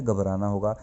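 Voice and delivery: ordinary talk, nothing marked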